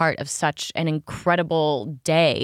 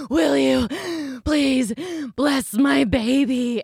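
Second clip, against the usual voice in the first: earnest plea; 6 semitones higher, much slower than usual. Retold or imitated. Husband asks Pope, breathless.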